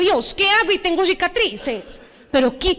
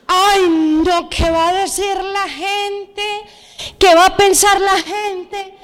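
whiny, drawn-out worry; hammed-up anxious voice; mock fretting; 3 semitones higher, slower than usual. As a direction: exaggerate the dread of gossip, stretching the words. Mocking worry about others' opinions.

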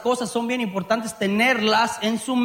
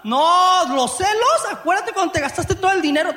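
laughing mock fight; 8 semitones higher than usual. Acted quarrel, laughing as he acts.

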